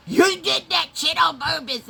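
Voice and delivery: high, loud accusing yell; mock-furious accusation; angry shout. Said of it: "So she goes".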